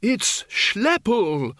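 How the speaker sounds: high, slow excited shout. A narrator's reading. Windle telling Reg who it is, shouted. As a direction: cry it out high and drawn out. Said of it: "shouted Windle".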